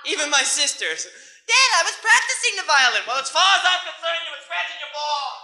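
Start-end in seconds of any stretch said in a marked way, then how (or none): 1.5-3.1 high, loud defensive cry; hammed-up plea of innocence; protesting daughter. Sister defending herself to dad, high mock protest. 3.1-5.4 loud, high, dismissive rant; hammed-up stubborn New York father; overruling dad. Stubborn dad dismissing his kid's excuse, loud mock scolding.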